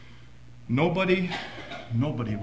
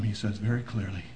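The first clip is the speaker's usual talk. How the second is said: ordinary talk, nothing marked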